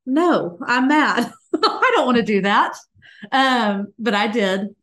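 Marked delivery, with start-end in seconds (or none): 0.1-2.8 raised voice, laughing through the protest; a laughing mock protest; laughing refusal. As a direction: laugh while you refuse, half joking. Laughing refusal of husband's suggestion.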